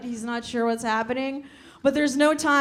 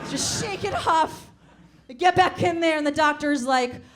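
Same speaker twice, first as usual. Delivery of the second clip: usual speaking voice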